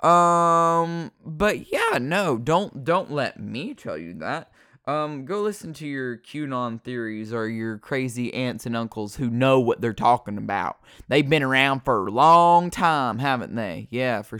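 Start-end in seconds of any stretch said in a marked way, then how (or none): none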